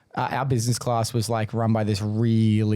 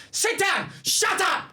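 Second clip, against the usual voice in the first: much higher, clipped command; strict teacher; slower than usual. Retold or imitated. Substitute teacher to rowdy class, stern command. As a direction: order the class to sit like a no-nonsense sub.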